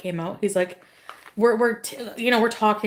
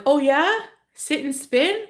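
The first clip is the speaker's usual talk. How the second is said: mocking angry retort; 8 semitones higher, slower than usual. Imitating the angry local man taunting her, mock high jeer. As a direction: mimic the man snapping back sarcastically. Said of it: "he was mad that I was".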